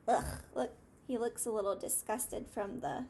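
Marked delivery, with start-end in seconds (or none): none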